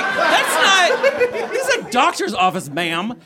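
very high, loud laughing protest; mock outrage. Patient protesting to her doctor, laughing. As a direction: squeal it in mock scandal. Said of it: "I was like".

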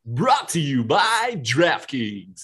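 drawn-out, hammed-up sponsor tag; cheesy recording artist. Co-host finishing the host's sponsor intro, hammed-up cheesy recording artist. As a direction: play it as a cheesy announcer. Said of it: "the cheesy, like, recording artist".

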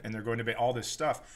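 ordinary talk, nothing marked

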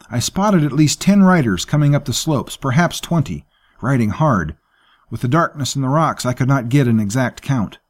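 anxious servant Safik, urgent. Acted. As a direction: kneel and report the riders urgently.

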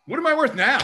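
high-pitched imitation of a player haggling; mock indignant demand. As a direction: push it up high as a cocky challenge. Player's imagined demand in contract talk.

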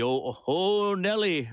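a quick nod to the classic football play-by-play catchphrase; a hint of a folksy college football announcer; Keith Jackson impression. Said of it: "isn't there a Keith Jackson sort of, um, character".

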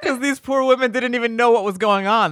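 normal talking voice

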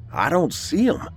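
high, worried realisation; alarmed. A narrator's reading. Man finds his dogs are missing, alarmed.

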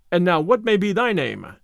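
ordinary delivery, nothing marked

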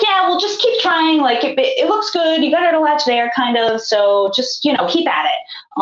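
high, offhand pep talk, imitated; breezy encouragement. Lactation consultant to struggling new mother, breezily encouraging.

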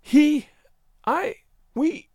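high, halting false starts; flounder, breaking off after each word; flustered and high-pitched. A narrator's reading. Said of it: "she began flounderingly".

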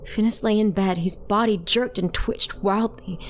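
usual speaking voice

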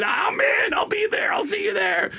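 squealed, very high acceptance; over-excited. Retold or imitated. Actor accepting a job without asking, over-excited. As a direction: blurt it at the top of your range. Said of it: "I got so excited".

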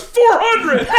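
high, loud giggling four hundred; high laughing kid bid. Retold or imitated. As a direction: blurt the bid through laughter. Daughter bids, laughing.

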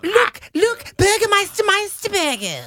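high, campy sidekick voice; camp sidekick voice. Retold or imitated. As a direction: squeal it like a fussy sidekick. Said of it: "He was like".

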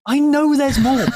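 squeaky high teasing voice; helium voice. Pretend. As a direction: pitch it up like a chipmunk. Repeated, first high take.

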